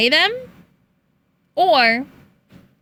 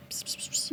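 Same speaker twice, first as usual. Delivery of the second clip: unvoiced psst psst to lure a cat; whispered psst calls; 16 dB quieter than usual. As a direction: hiss softly like calling a kitten. Calling cats.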